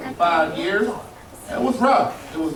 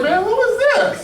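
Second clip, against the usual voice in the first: shocked; 14 semitones higher than usual. Retold or imitated. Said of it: "I'm like".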